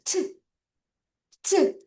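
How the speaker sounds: 트 as a sharp puff of air; spitty airy T. Shown as a demonstration. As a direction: let 트 burst out as if spit might fly. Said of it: "침도 약간 튀길 수 있지".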